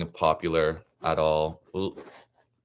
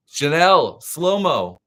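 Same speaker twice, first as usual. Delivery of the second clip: excited; 7 semitones higher, 7 dB louder, much slower than usual. Real, not acted. Reacting to a favourite act.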